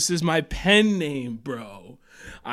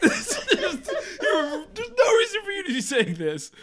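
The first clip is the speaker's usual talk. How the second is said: high-pitched laughing complaint; laughing while talking. Real, not acted. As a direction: crack up while scolding. Host cracks up mid-complaint.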